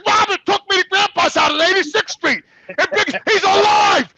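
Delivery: high, loud shout building to a stretched-out climax; retold furious disbelief; outraged shouting. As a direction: act out yelling the news at mother.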